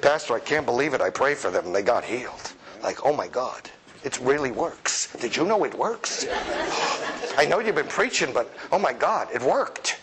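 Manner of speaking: stunned and whispering in disbelief; astonished, hushed. Retold or imitated. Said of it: "the most surprised person".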